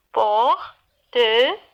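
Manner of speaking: PORTEFEUILLE as three detached beats, por, te, feuille; split into syllables. Shown as a demonstration. Teacher repeating for the class, "portefeuille" broken into three syllables. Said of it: "Trois syllabes".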